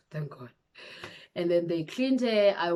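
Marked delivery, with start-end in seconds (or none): 0.0-0.5 relieved sigh. Retold or imitated. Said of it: "I was like, sighs".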